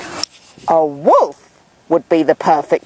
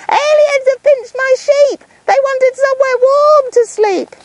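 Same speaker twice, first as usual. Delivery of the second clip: put-on much higher, louder panicked kid; screaming panic; 3 dB louder than usual. Nick screaming to the town about his sheep, frantic. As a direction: shriek it running downhill. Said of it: "if screaming he came running down".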